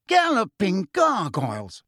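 slow, shocked cry; startled exclamation. A narrator's reading. Fudge seeing Marietta's face, mildly startled. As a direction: Fudge recoiling in fright. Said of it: "leapt backward in shock".

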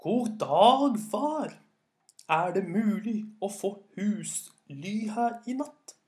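big, high-pitched, carrying storyteller call; loud voice. A narrator's reading. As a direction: speak up strongly to someone hard of hearing. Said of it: "Så sa han med en dyp, høy stemme".

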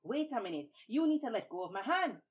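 indignant protest. Acted. Hand stuck, Babuki protests.

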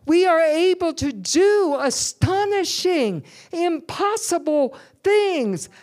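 loud, driving sermon delivery, pushing each phrase; full-force preaching. Acted. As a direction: preach it hard to the whole congregation.

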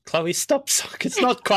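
ordinary talk, nothing marked

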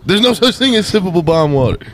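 high comic imitation of a punchline; Hannibal Buress. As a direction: pitch it up like a mimicked stand-up. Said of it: "And he says".

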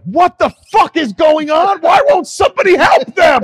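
very high, loud, frantic yelling; panicked shout. Retold or imitated. Girlfriend terrified by the movie, yelling at him. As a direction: shriek it like it's really happening. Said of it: "She was just like".

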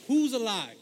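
a disciple yells the question, loud and high; yelled question. Retold or imitated. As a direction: shout it across the crowd. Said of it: "finally someone yells".

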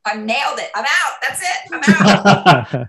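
high-pitched comic quoting of a triumphant exit; playful imitation of a sitcom walk-out; Jason Alexander. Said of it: "when he was".

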